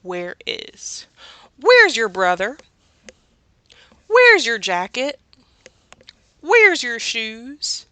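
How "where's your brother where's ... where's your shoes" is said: louder, higher calls of Where's, last one slow; hammed-up nagging parent; funny scolding parent. Shown as a demonstration. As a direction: call out like a parent nagging a kid. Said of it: "Remember, think about funny".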